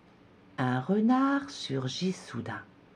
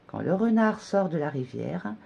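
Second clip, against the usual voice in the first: ordinary delivery, nothing marked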